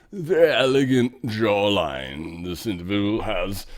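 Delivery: slow, put-on British voice; British accent. Host describing someone's jawline, mock British accent. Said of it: "I'm making them British".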